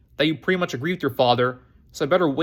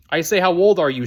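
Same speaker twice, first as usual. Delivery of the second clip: raised voice, as if to someone hard of hearing; shouting; 3 semitones higher, 6 dB louder than usual. A narrator's reading. Chloe teasing Buck as a deaf old man, shouting. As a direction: say it loudly and emphatically for deaf ears. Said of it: "she shouted, as if talking to a deaf old man".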